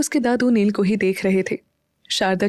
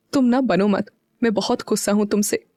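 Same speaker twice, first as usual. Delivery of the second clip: usual speaking voice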